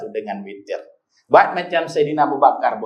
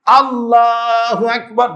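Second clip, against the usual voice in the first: takbir call; 8 semitones higher, 3 dB louder, slower than usual. Retold or imitated. Imam calling takbir.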